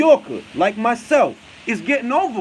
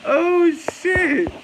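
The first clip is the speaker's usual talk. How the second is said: amused shock; 9 semitones higher, 3 dB louder than usual. Real, not acted. Reacts to the comment while still laughing.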